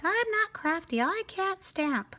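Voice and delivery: high-pitched, drawn-out mock protest; put-on high complaint, dragging the last words; mock protesting friend. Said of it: "And they're like".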